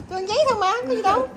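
high, pleading mock pet voice; pet's voice. Hungry pet defending eating paper, high playful protest. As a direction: argue in a high little pet voice.

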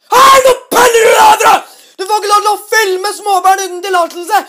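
a loud, high bellow, close to a scream; full-force furious yell at the man; real-volume shout. Shown as a demonstration. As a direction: shout it as loud as he really did. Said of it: "jeg kan si det han sa på ekte".

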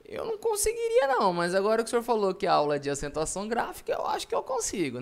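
high-pitched mock student voice; student's reply. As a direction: pipe up high and loud as a pupil. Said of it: "Aí você vai falar".

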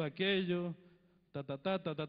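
usual speaking voice

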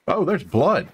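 high-pitched surprised "oh"; real, high-pitched surprise; surprised discovery. Real, not acted. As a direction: pipe up in surprise at a find. Surprised to find blood trail.

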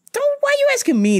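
very high, fast outraged squeal. Retold or imitated. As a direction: burst out in disbelief. Peak exasperation.

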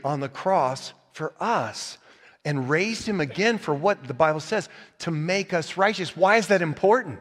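normal talking voice

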